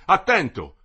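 loud, high cry of alarm; shouted warning. A narrator's reading. The delegate warns the marshal before the shot, shouted warning. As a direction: warn him with a sharp yell. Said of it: "gridò".

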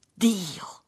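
high, breath-filled gasp of a word; startled, high exclamation; exclaiming. A narrator's reading. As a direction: cry out in alarm, half whispered. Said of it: "esclamò lei".

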